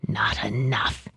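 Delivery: low, soft, slow echo; understated cold repeat. A narrator's reading. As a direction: murmur it again to himself. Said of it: "he repeated".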